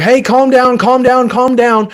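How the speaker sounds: loud, high, rushed pleading; frantic calm down. Shown as a demonstration. Parent rushing in at a panicked child, loud and frantic. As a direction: rush in frantically and shout it high. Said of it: "rushing in and being like".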